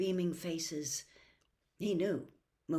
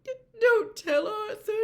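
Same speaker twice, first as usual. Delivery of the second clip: sobbing stammer; 11 dB louder than usual. A narrator's reading. Missus Weasley confesses her nightmares, sobbing and stammering. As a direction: choke each word out through tears.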